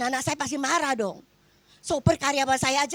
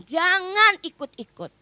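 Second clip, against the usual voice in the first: firm warning; 8 semitones higher, 7 dB louder, slower than usual. Retold or imitated. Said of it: "saya katakan".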